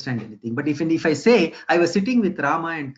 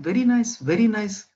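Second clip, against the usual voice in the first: surprised praise; 7 semitones higher than usual. Retold or imitated. Friend reacts to odd dog name.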